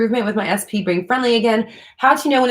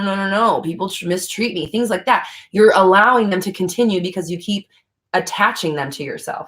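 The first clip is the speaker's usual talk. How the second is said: ordinary talk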